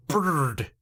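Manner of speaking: 'bird' with a long, hard trilled R; mom's rolled R. Retold or imitated. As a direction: imitate mom rolling the R in 'bird'. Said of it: "doesn't know how to do the er sound softly".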